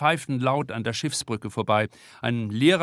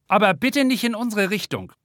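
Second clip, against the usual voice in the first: alarmed; 9 semitones higher, 4 dB louder than usual. A narrator's reading. Twins recoil from vomiting boy.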